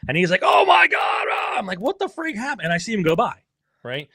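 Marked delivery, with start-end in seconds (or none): 0.4-1.5 high, shouted race-announcer shock; mock frantic commentary yell; Roland Sands. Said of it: "Roland Sands was announcing it". 1.8-2.7 high-pitched baffled question; acted bewildered squeal; retold alarm. Retelling own alarm at supposed crash.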